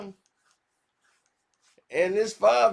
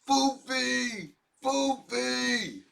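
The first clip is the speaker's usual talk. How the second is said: higher, squeaky put-on voice; mimicked pleading, pitched way up; mock whine; 8 semitones higher, much slower than usual. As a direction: whine the nickname in a silly high voice. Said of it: "screaming about publishing".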